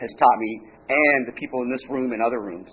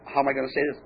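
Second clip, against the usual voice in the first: ordinary talk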